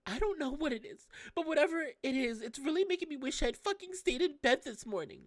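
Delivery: put-on high girl's voice, bitter and rising; Tsitsi lashing out. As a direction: snap back at him as the hurting girl.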